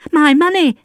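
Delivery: put-on high, loud child's cry. A narrator's reading. Boy crying out to Valjean for his money, loud. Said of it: "the boy cried".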